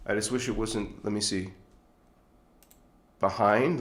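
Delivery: ordinary talk, nothing marked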